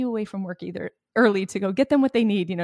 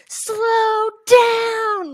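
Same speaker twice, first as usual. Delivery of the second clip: loud, much higher demanding shout; shouting slow down; 13 semitones higher, 9 dB louder than usual. Shown as a demonstration. Mama bear yelling at the speeding van driver, furious shout. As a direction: bellow it after a speeding van. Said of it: "like this".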